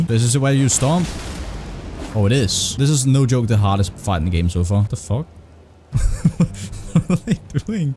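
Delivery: ordinary talk, nothing marked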